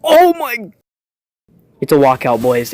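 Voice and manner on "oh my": celebrating a lucky pull; excited. Real, not acted. Gamer revealing De Gea, thrilled and high.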